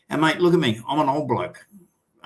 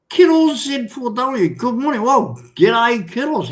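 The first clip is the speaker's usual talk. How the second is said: cheery greeting; 10 semitones higher, 5 dB louder than usual. Real, not acted. Greeting a commenter by name.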